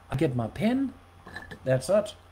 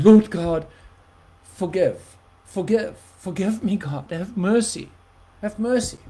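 louder, crying out for mercy; pleading repentance; 4 semitones higher, 7 dB louder than usual. Retold or imitated. Preacher reliving his repentance, loud pleading cry. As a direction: beg God for forgiveness. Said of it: "full repentance mode".

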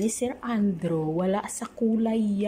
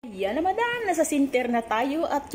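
high teasing call; 7 semitones higher than usual. Acted. Calling to Madam on camera.

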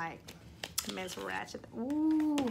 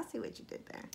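whisper; 3 semitones higher, 13 dB quieter, faster than usual. Real, not acted. Repeated line, now whispered.

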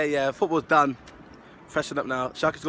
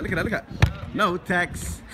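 ordinary talk

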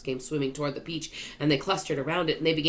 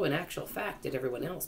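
usual speaking voice

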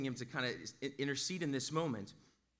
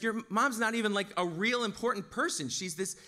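louder, higher put-down; raised, dismissive retold insult; belittling; 6 semitones higher, 4 dB louder than usual. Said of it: "He's trying to lower his status".

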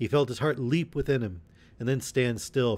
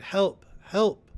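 high, loud calls; crying for help; 10 semitones higher, 6 dB louder than usual. A narrator's reading. Some in the fray, crying for help. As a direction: cry out twice, high and urgent. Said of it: "and some cried".

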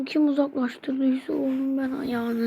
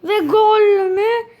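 surprised; 5 semitones higher, 10 dB louder, much slower than usual. Real, not acted. Goal chance in football game.

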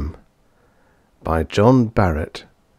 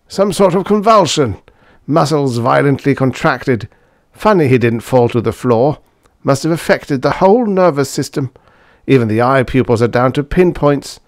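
high, raised character voice; doctor giving findings; 6 semitones higher, 5 dB louder than usual. A narrator's reading. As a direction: report symptoms as the examining doctor.

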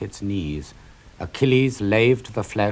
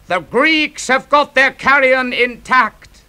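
much higher, louder triumphant shout; Hector's shout; 9 dB louder than usual. Acted. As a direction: bellow it across the battlefield. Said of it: "as Prince Hector shouts".

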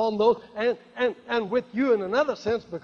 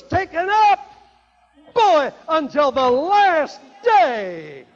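very loud, high, drawn-out proclamation; climactic preaching; 7 semitones higher, 9 dB louder, slower than usual. Acted. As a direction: build to a ringing sermon climax at full force. Preaching at full force.

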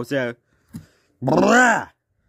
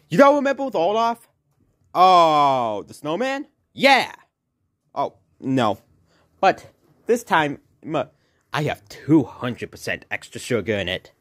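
usual speaking voice

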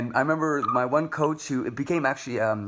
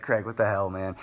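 ordinary talk, nothing marked

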